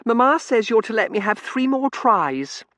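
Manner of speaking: put-on high, loud child voice; piercingly high imperious little girl; demanding little girl. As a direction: announce it like a bossy child.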